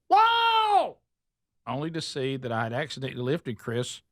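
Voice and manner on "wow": very high, loud squeaky elf; little elf voice. Retold or imitated. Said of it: "in his little elf voice".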